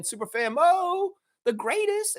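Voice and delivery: high, slow hype-man call; hyping up the chat's superfan, drawn-out and high; hype announcement. Real, not acted. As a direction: announce her like a star. Hyping up a chat fan.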